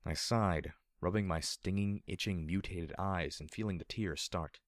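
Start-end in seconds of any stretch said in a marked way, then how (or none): none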